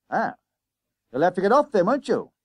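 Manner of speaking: loud, high conductor voice; insistent bus conductor. Acted. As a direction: order the passengers off, pitched high. Conductor orders boys off.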